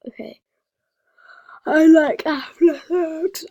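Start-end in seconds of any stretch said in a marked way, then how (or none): 1.6-3.4 loud proclamation. Real, not acted. Loud declaration.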